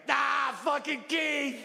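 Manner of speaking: high-pitched, drawn-out delighted yell; hammed-up crowd whooping; raucous fan. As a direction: holler it like a thrilled concertgoer. Acted cheering of rowdy audience.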